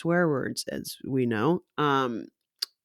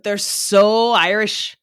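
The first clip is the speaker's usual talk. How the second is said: SO drawn out and loud; emphatic; 3 semitones higher, 7 dB louder, slower than usual. Real, not acted. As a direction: stretch SO, leaning hard on it. Slipping into attempted Irish accent.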